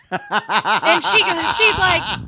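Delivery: laughing hard mid-sentence. Real, not acted. Retelling the manager's reaction: laughing hard. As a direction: lose it laughing.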